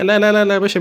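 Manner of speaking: rising outraged لا لا لا; loud protest. Real, not acted. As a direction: push back hard at the ref. Protesting a penalty call.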